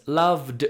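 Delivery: "loved" with a short d ending and the og dropped. Shown as a demonstration. Teacher demonstrating the d ending: clipped "loved". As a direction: clip "loved" to a quick d. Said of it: "and then you cut it short".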